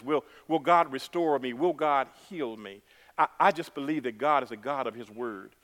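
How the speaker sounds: ordinary talk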